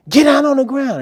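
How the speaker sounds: high, loud commanding yell; shouted police order. Retold or imitated. Police to suspect with hands on guns, shouting. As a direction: shout it like an armed officer.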